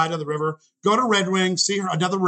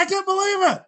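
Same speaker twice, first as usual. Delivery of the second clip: much higher, stunned disbelief; floored by what he sees; high amazed exclamation; astonished; 13 semitones higher than usual. Retold or imitated. Artist sees the face.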